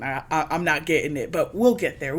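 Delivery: ordinary delivery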